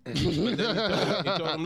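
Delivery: can't stop laughing while telling it. Real, not acted. Cohost laughing as he starts the retelling, laughing loudly.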